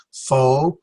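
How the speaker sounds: "phone" split as FO, pause, NAY; syllable by syllable. Shown as a demonstration. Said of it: "gonna be two syllables".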